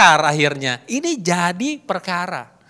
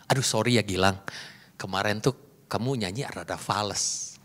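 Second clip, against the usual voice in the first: soft, low apologetic criticism; polite; 9 semitones lower, 9 dB quieter than usual. Shown as a demonstration. Speaker to Gilang, softening criticism of his singing, politely. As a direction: sugarcoat it gently, voice lowered. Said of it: "ngomongnya politely".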